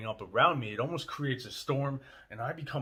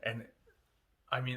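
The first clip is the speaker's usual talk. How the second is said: usual speaking voice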